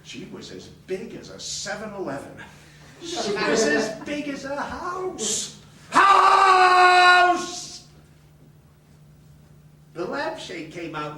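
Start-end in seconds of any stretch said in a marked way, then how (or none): 3.0-5.6 emphatic. Real, not acted. Exaggerating the mother's size. 5.9-7.7 full-throated comic yell; yelled punchline. Real, not acted.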